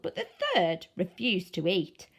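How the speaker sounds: usual speaking voice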